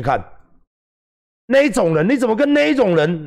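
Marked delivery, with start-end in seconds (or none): 1.5-3.3 jab at him like an outraged fan; mock accusing fan. Voicing angry fan's accusation.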